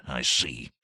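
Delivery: low, soft grunt of words; grunted reply. A narrator's reading. Count grunts his answer to the satyr, low. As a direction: grunt it under your breath. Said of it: "The Count grunted".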